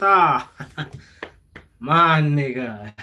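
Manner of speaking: ordinary talk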